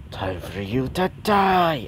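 slow, strained demonic threat. Acted. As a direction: draw it out like a menacing monster. Transformed demon threatens to kill.

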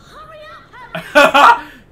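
loud, high cackle; big high laugh; burst of laughter. Real, not acted. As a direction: explode laughing. Laughing at demanding character.

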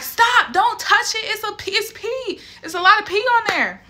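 frantic protest. Retold or imitated. Sister stopping brother grabbing the pee-covered test, high and frantic. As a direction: squeal it out high to stop him.

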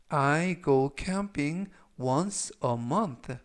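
very slow, every word spaced for learners; slow read-aloud. Shown as a demonstration. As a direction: read it slowly for students to repeat. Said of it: "제가 역시 천천히 세 번씩 읽어드리고요". (따라 읽기 좋게 아주 천천히)

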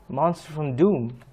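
usual speaking voice

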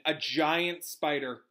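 plain reading voice

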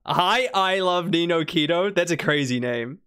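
ordinary delivery

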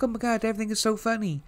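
high, fast mock squeak; high-pitched giddy imitation of a woman treating it as a joke; squeaky giggly voice. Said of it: "she still has a squeaky voice".

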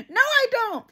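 bardzo wysokie, oburzone zaprzeczenie; indignant denial. Retold or imitated.

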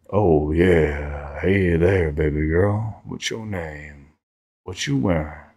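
low, slow seductive pickup voice; mock sexy talk. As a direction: lay on a cheesy low flirt. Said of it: "That was terrible".

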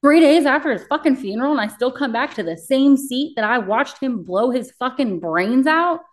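high and loud, soaring on the last words; outraged disbelief. Real, not acted. Telling the host how soon she had to return, outraged high cry. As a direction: pour out the injustice of it. Recounting returning after funeral.

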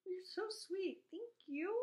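high, slow, cooing thanks; touched, mock teary. Touched mother thanking her son, mock teary. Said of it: "made me cry again".

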